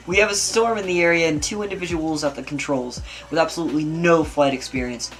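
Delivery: ordinary talk, nothing marked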